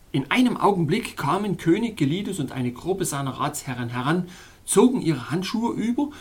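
ordinary delivery, nothing marked

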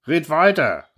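high-pitched impatient urging; mildly called-out heckle; calling out. A narrator's reading. Someone at the back urging the speaker, calling out. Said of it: "rief jemand von hinten".

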